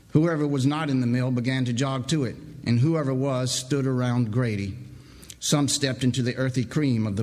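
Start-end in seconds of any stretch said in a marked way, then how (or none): none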